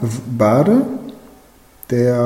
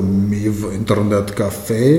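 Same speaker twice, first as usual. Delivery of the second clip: heavy Russian accent, lower; Russian oligarch accent; 4 semitones lower than usual. Shown as a demonstration. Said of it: "ein russischer Oligarch klingen".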